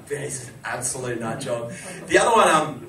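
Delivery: ordinary talk, nothing marked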